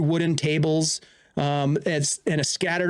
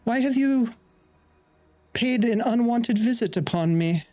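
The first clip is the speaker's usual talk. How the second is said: loom over the intruder with each word; menacing sorceress; 7 semitones higher than usual. Acted. Sorceress demanding why the sneaky one intrudes, high and menacing.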